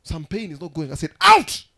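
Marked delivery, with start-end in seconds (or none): 1.2-1.6 sudden high, very loud shout; shouted command. Retold or imitated. Preacher ordering pain to leave, shouting. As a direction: bellow one word to drive pain away. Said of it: "sometimes I shout in the house".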